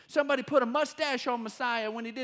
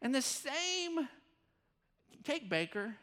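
normal talking voice

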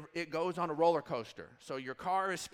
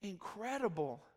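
softer, breathless amazement; awed hush; 8 dB quieter than usual. Retold or imitated. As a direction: whisper it in wonder. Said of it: "Then he was like".

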